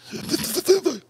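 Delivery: high sputtering mouth noise; spluttered fit sound; imitated convulsing noise. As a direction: mimic a man seizing with a quick sputter. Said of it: "the guy does this".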